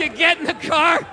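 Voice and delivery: ordinary delivery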